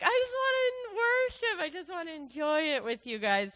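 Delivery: high, tear-choked inner plea; tearful longing. Retold or imitated. Quoting her own wish to worship with the church, high and tearful. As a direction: plead through tears. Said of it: "Like, I just was like".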